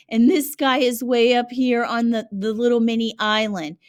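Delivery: normal talking voice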